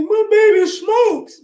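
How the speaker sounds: high, slow, shocked mom voice; mother flipping out. Retold or imitated. Mother finding out her son smokes, high slow dismay. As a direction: wail it like a scandalized mother. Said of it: "my own mother flipped out".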